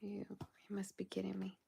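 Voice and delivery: whispered disbelief at the hour. Real, not acted. Realizing it's past midnight on stream, whispered. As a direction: hush it, amazed it's so late. Noticing how late it is.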